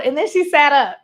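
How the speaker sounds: normal talking voice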